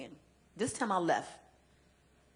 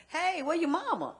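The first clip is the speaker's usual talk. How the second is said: trucker's voice; 9 semitones higher, 5 dB louder than usual. Retold or imitated. Trucker asks about her mother.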